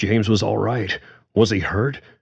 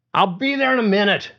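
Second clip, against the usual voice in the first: much higher, fast call back; shouted back; faster than usual. Acted. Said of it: "Paulie shouted back".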